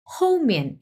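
হোমিয়ান said quickly, both syllables falling; quick falling tones. Shown as a demonstration. Teacher shows হোমিয়ান quickly to students. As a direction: clip হোমিয়ান fast, falling each beat. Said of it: "একটু তাড়াতাড়ি উচ্চারণ হবে".

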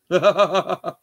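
loud, high burst of laughter; big high cackling laugh. Real, not acted. Laughs after joking about the song.

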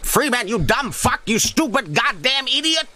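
high-pitched hysterical scientist; panicked Half-Life scientist. Acted. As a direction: squeal in doom-laden desperation. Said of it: "quoting Half-Life scientist quotes".

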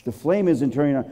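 ordinary talk, nothing marked

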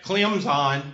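"Clemson" said as "Clem-zine", no P; Clemson without the P. Shown as a demonstration. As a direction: drop the P in "Clemson" and voice the S as Z. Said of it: "say it like the E S P N announcers".

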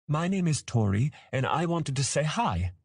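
put-on high-pitched childlike voice; cheerful young dragon. A narrator's reading. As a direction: introduce yourself like an eager little one. Young dragon introduces himself.

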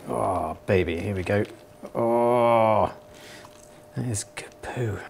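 low, soft, drawn-out mock delight; mock pleasure. Presenter cooing over fake poo, low and soft. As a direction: croon over the poo like a treat.

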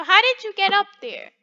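usual speaking voice

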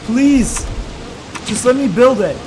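loud, high begging shout; frustrated plea. Real, not acted. Gamer yelling at the game to let him build, exasperated.

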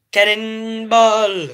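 drawn-out jump cry; cannonball yell. Acted. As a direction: stretch it out as you leap. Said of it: "jumping on bed".

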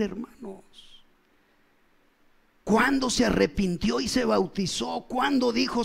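ordinary talk

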